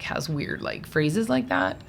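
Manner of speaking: normal talking voice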